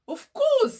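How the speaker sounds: high, loud, warm of course; hearty reassurance. A narrator's reading. Joe reassures little Riley, bright hearty yes. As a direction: sweep the doubt away.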